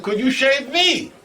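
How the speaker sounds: very high pleading question; spring the favour in a high voice; eager request. Acted. Igor springs request on girlfriend.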